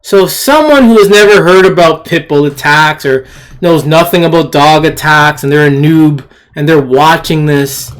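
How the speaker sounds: ordinary delivery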